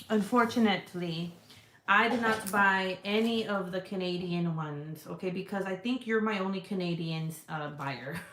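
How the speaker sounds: normal talking voice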